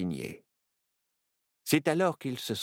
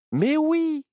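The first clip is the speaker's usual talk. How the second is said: very high, loud exclamation; high-pitched eureka from a little owl; excited cry; 6 dB louder than usual. A narrator's reading. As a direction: cry out with a sudden idea. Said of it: "s'écria Myrtille".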